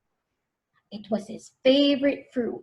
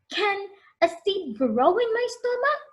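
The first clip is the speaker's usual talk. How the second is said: put-on higher anxious child question; worried boy; 9 semitones higher than usual. Connor asks the farmer, worried. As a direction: ask it again, fretting. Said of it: "he asked".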